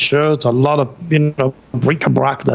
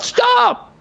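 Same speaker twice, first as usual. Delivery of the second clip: very high screamed stop; frantic high shout. Retold or imitated. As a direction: yell to stop the bus. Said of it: "I scream".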